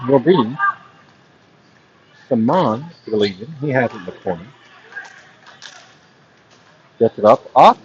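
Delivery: hushed, low golf commentator; golf announcer voice. Acted. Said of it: "like, a golf announcer voice".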